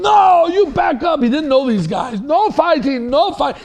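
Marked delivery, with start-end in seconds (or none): 0.0-1.2 loud, high-pitched command to back off; retold shout. Reverend charging between two fighters, shouting. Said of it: "He had an accent". 2.2-3.6 high, loud, urgent command; retold shouted order. Reverend ordering two young men apart, shouting. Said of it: "He had an accent".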